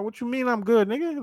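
high, challenging "what you mean"; mock confrontational face. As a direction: push back like you're sizing him up, not aggressive. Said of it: "I put on that face".

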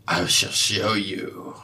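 normal talking voice

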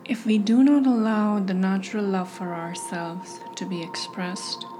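hushed self-love meditation guide; guided meditation. Acted. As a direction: ease the listener into calm, breath by breath.

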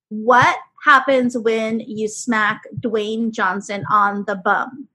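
slow, deliberate riddle; slow joke setup. Acted. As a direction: draw out the setup slowly.

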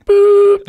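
high, loud sustained boop; button beep. Pretend. Host pretends to press a speak button, loud beep.

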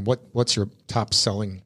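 ordinary talk

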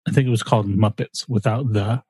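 ordinary talk, nothing marked

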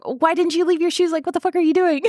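high-pitched mock scolding; exasperated scolding. Recapper voicing the squad at the shoeless kid, exasperated. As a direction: imitate incredulous friends telling someone off.

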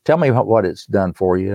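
ordinary talk

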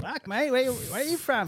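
high, loud mock Australian accent. Australian locals asking the American where he's from, high Australian accent. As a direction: squeal it like an excited Aussie local. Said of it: "And they're like".

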